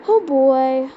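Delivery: normal talking voice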